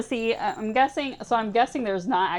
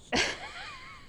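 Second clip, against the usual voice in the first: choked laugh; 6 dB quieter than usual. Real, not acted. Laughs after crying out.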